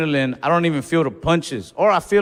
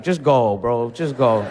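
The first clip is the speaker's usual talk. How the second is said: lower, gentle "just go"; lightly voiced pitying opponent; 6 semitones lower, slower than usual. Opponent feeling bad, lightly lower pitying voice. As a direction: dismiss him kindly, a bit sorry for him.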